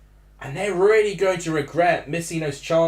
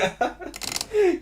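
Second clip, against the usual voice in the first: high embarrassed laugh. Real, not acted. Laughing at own outburst.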